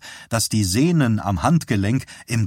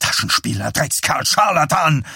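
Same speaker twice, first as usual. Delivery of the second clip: angry shout; 5 semitones higher than usual. Acted. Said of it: "schrie Dussault ihn an".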